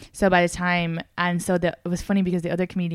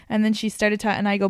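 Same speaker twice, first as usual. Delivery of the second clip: ordinary talk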